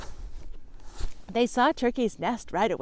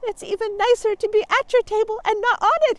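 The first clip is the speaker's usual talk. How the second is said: high, louder cheerful turkey; 13 semitones higher, 7 dB louder than usual. Acted. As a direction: beam with relief at the table. Turkey's toast.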